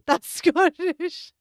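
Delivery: high, slow words squeezed through laughter; laughing at your own blunder; cracking up. Real, not acted. Cracking up at own failed accent.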